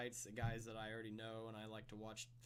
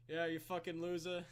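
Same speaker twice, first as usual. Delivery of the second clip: put-on sneering Boston accent, a touch louder and higher; 5 semitones higher, 6 dB louder than usual. Mocking a sarcastic bad-boy fighter in a Boston accent. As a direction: jeer it like a cocky Boston tough guy. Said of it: "I just went for, like, a Boston accent".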